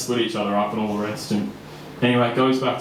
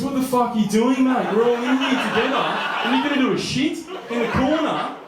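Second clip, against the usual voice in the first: very high-pitched, scandalised protest; mock outrage; 13 semitones higher than usual. Young cellmate confronting the man at the toilet, hammed-up outrage. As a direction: imitate an outraged lad, voice shooting way up.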